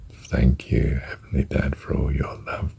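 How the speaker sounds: softly whispered prayer mantra. Shown as a demonstration. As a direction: breathe the mantra out in a whisper while holding.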